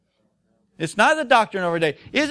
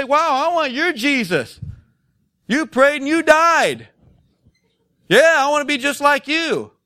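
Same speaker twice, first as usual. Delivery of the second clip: sarcastic; 5 semitones higher, 3 dB louder than usual. Acted. Preacher voices the world admiring unanswered prayers, sarcastic. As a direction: play awestruck, with heavy sarcasm. Mocks the world's supposed reaction.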